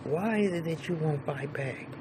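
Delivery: a softly voiced puzzled boss; hushed mock question. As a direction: probe him quietly. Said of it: "He said".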